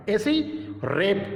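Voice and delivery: ordinary delivery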